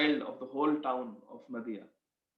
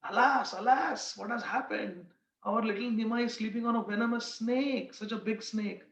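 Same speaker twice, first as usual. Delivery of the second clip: mock alarm; 6 semitones higher than usual. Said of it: "They were screaming".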